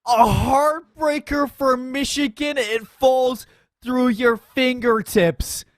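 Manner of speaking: slow, dramatic wrap-up; esports caster. Acted. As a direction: let the moment land.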